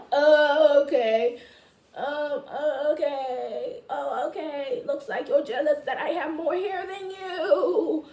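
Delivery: high, loud, giggling sing-song taunt; laughing taunt. Acted. To a boy who teased her, laughing sing-song taunt.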